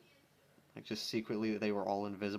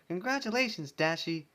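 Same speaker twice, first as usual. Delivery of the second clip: high, loud Pinkie congratulation; bubbly cheer; 7 semitones higher, 5 dB louder than usual. Acted. Pinkie congratulates Dashie, bubbly. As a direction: bounce it out.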